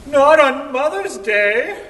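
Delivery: high, loud, slow outcry; shocked companion. Retold or imitated. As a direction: cry out in dismay. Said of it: "and he's like".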